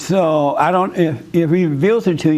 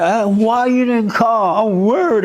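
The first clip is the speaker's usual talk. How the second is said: higher, slow mock wife voice; a hint of a fretting wife, higher and slow; imitating wife; 6 semitones higher than usual. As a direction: play the worried wife, drawn out. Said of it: "she said".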